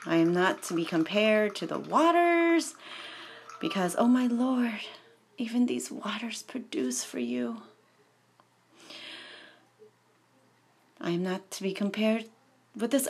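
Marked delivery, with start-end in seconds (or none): 5.4-7.6 whisper. Acted. Hushed line within the lament.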